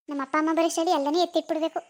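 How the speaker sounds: ordinary delivery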